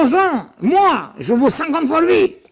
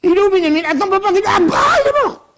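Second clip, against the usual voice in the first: frantic father scene; 7 semitones higher, faster than usual. Acted. Teacher acting the father's cry, then the brothers' panic: high alarmed shouting. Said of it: "Il va dire".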